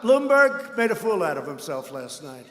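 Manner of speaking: ordinary talk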